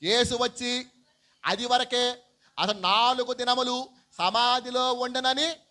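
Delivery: loud, high reading with drawn-out words; scripture reading. Acted. Preacher reading a verse to the congregation, loud scripture reading. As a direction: declaim it slowly and loudly.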